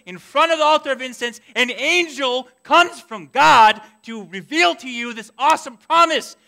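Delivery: loud, high, incredulous reminder; exasperated scolding. Acted. Readers reminding Zechariah of God's promise, loud exasperation. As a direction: plead loudly, hammering each reminder home.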